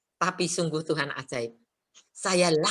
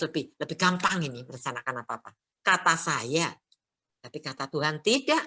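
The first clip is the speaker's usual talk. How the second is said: normal talking voice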